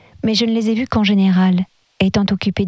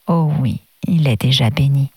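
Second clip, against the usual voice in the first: a gentle, low, fatherly reassurance; lower, tender "il est déjà béni"; gently; 6 semitones lower than usual. A narrator's reading. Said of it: "avec douceur".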